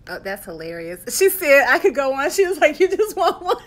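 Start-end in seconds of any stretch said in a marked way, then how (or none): 1.2-3.7 laughing. Real, not acted. Reading comment, laughing loudly.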